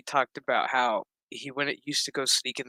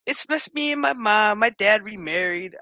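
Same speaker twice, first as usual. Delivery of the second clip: put-on smug kid voice; 6 semitones higher, 4 dB louder than usual. Kid gloating after fake sad story.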